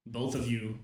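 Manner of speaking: ordinary talk, nothing marked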